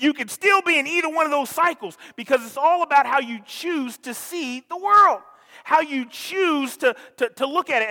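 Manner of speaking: loud, high, driving sermon delivery; pushing the point home to the congregation; full-force preaching. Acted. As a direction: preach it with rising fire.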